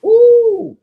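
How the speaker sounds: long, high, loud "ooh"; real burst of appreciation; impressed ooh. Real, not acted. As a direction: let out a delighted, drawn-out whoop. Reacts to a gem of advice.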